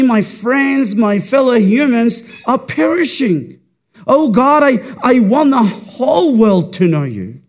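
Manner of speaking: rising to a loud pleading cry; impassioned prayer. Acted.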